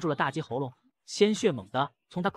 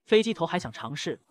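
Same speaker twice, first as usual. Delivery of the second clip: usual speaking voice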